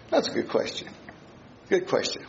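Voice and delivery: soft breathy whisper to himself; whispered aside. Real, not acted. Preacher musing to the congregation in a whisper. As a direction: let it slip out as a hushed aside. Teacher's question echoed as aside.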